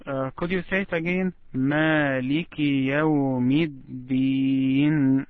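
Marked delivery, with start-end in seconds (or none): 1.5-5.3 slow, measured recitation with long vowels; Quranic recitation. Shown as a demonstration. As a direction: model the verse for a pupil, slowly drawn out.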